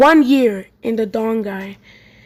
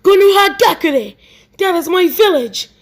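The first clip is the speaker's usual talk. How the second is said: mild scream; 6 semitones higher than usual. A narrator's reading. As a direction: exclaim it like a restrained scream. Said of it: "gets up and screams".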